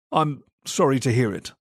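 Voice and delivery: ordinary delivery, nothing marked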